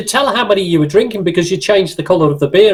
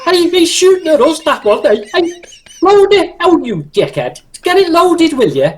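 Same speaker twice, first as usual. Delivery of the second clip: high, excited Scouse tank commander; Scouser playing tanks; 7 semitones higher than usual. Scouser ordering his tank crew under fire, agitated role-play. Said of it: "a Scouser plays tanks".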